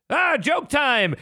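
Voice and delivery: high, drawn-out comic announcement; King Kai. Retold or imitated. King Kai breaks off the training talk, mock-grand reveal. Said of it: "he builds up like".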